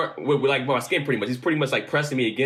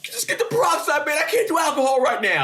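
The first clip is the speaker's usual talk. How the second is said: much higher, strained whining plea; panicked pleading; 14 semitones higher than usual. Retold or imitated. To his dad and brother about the wound, high panicked plea. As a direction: whine like a scared kid begging. Begging dad not to use alcohol.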